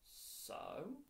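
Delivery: high rising questioning "so?"; curious, drawn-out upswing; questioning prompt. A narrator's reading. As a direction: prompt them to go on, pitch swooping up. Dennis prompts Lisa to continue.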